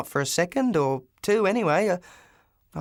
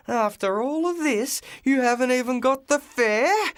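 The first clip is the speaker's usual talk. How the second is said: higher, outraged yell; furious scream; 8 semitones higher than usual. Acted. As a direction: scream it at the broke passenger, higher. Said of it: "he screamed".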